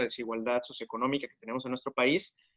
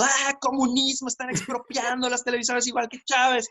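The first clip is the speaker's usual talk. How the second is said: mock outcry; 9 semitones higher than usual. Teacher mimicking people shouting about expropriated broadcasters, mock outcry. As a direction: cry it out in hammed-up panic. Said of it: "toda la gente gritando".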